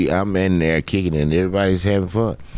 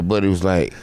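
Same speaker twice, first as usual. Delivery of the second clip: normal talking voice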